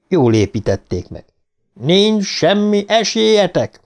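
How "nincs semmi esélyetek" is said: high, drawn-out mock police yell. A narrator's reading. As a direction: stretch it out high without much added volume. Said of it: "üvöltötte". (megjátszott, elnyújtott rendőrkiáltás)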